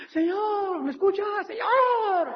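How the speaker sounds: high, wailing mock crying to God; whiny frantic prayer. Preacher mocks frantic prayer in high wailing voice. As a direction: mimic a panicked believer begging. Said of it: "no puedes estar hablando".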